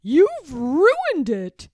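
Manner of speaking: anguished cry. Acted. George sees his ruined painting, loud anguished cry. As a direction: wail it out, horrified. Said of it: "he cried".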